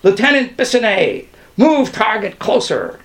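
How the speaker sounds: moderately loud officer's yell; yelled order. A narrator's reading. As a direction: call the order across the room. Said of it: "Plantinga yelled".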